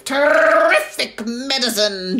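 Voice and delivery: put-on a wild old witch crowing with glee; screechy old grandma shout. A narrator's reading. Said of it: "she cried".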